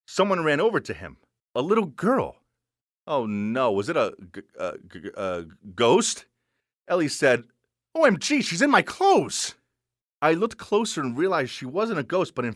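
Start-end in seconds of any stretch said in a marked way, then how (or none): 3.1-6.2 low, halting fearful stutter; spooked, stuck on the first sound; frightened stammer. Acted. Narrator creeped out, stuttering at ghost. 7.9-9.5 put-on high, loud girlish outrage; dramatic, high-pitched OMG; shocked exclamation. Ellie exclaims over stolen clothes.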